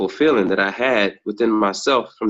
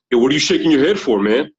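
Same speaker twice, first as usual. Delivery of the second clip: challenging stranger; 4 semitones higher, 4 dB louder, faster than usual. Retold or imitated. Stranger on stairs confronts him.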